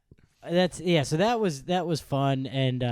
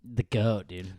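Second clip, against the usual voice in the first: heavy bro voice; 6 semitones lower, 4 dB quieter, much slower than usual. Pretend. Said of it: "like, heavy bro".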